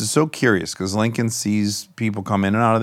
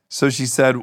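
ordinary talk, nothing marked

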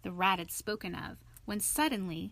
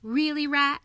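high, loud, peevish rebuke; petulant and fed up; pettish; 6 semitones higher, 8 dB louder than usual. A narrator's reading. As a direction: scold a friend pettishly. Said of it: "said the mole quite pettishly".

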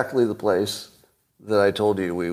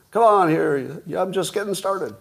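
higher, defiant mock-brave knight; Monty Python knight; 8 semitones higher than usual. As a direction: play the limbless knight who won't quit. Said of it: "sort of like that knight in, you know, Monty Python".

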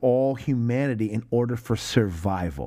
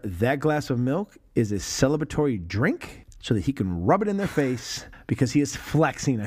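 ordinary talk

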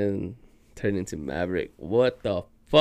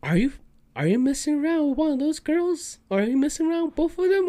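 imitating mom; faster than usual. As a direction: poke at him like a prying mother. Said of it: "my mom's all like".

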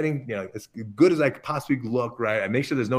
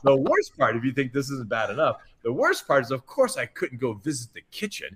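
ordinary delivery

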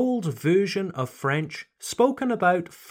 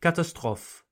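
catastrophe said French-style, ka-ta-STROF, no final E; French catastrophe for contrast; 2 semitones higher than usual. Shown as a demonstration. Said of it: "not quite the same as the French".

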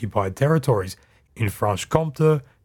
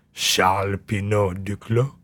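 slow, lower French accent on the name; 4 semitones lower than usual. Shown as a demonstration. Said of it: "have to say in a French accent".

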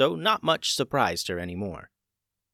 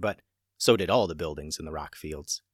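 usual speaking voice

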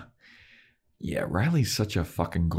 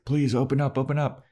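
higher-pitched retold plea to be let in; mock pleading; 7 semitones higher than usual. Spike begs at Giles's door, mock pleading. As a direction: imitate someone urgently begging entry.

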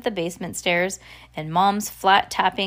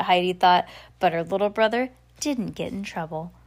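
usual speaking voice

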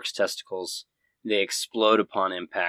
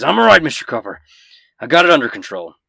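much higher and louder, forcing it out through pain; pained shout; 5 semitones higher, 13 dB louder, much faster than usual. Acted. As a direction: yell that you're fine while hurting. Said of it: "He cries out in pain. He says".

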